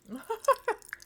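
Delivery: high-pitched giggle; short burst of high giggles; laugh. Real, not acted. Streamer laughing at own remark to chat, high giggle. Laughs after asking not to be judged.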